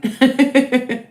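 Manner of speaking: a bright, high giggle of delight; pleased laugh. Real, not acted. Psychic laughing as a viewer thanks her on the live stream.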